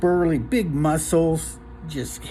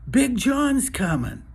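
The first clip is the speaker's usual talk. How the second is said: high, drawn-out alarm call; mock warning cry; 9 semitones higher, slower than usual. Owner imitating a man warning the town, high mock warning cry. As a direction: call it out slowly, higher, without full volume.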